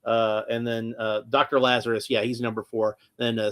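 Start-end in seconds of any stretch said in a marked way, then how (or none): none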